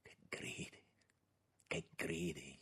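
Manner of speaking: very soft, half-whispered rebuke; hushed reproach. A narrator's reading. Bowed minister rebukes the young man, in a hushed voice. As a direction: breathe it out low and soft. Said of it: "scotendo le mani a ogni parola".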